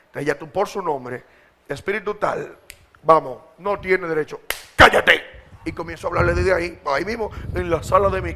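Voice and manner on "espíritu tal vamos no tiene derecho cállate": building to a loud cállate; aggressive rebuke. Shown as a demonstration. Preacher rebuking an evil spirit, building to a shout. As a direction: order the spirit out, harder each word. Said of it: "ya entra el agresivo".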